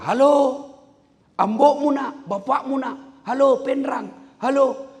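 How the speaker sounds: loud, high yelling into a phone; shouting into phone. Retold or imitated. Old rich man shouting into the wartel phone, loud and high. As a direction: bellow down the line like a villager on his first call.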